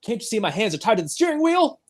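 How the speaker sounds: imitating a frantic protest; exasperated plea. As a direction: plead quickly, as if exasperated. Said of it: "after Max says".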